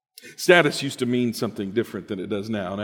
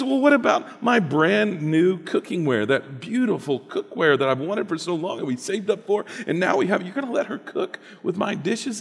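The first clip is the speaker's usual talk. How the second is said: upset wife; 8 semitones higher than usual. Acted. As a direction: protest over the cookware. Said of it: "And she says".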